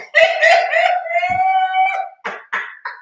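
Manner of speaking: loud, high shriek of laughter. Real, not acted. Host shrieking with laughter about making videos. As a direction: howl with laughter.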